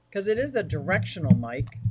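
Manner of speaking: usual speaking voice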